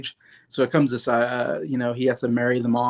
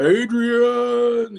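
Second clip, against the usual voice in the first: high, drawn-out call of her name; imitating Rocky yelling for Adrian; 11 semitones higher than usual. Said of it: "He's like".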